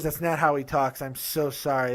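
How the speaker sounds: ordinary delivery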